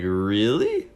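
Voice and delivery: retold surprise, rising sharply at the end; astonished question, acted out; surprised. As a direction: ask it with a big upward swoop. Acts out his surprised reply.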